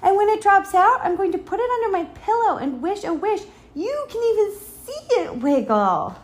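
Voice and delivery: put-on high, loud, eager child; thrilled little-girl chatter at full voice; excited shouting. A narrator's reading. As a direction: rattle it out with bursting excitement. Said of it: "she shouted".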